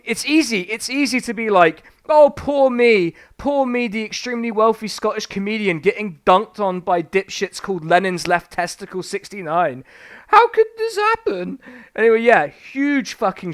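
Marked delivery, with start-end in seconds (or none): 2.1-11.6 mocking whiny Frankie Boyle. Streamer mocking a comedian's self-pity, whiny impression. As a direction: whine like a wounded rich comedian. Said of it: "That's my impression of Frankie Boyle".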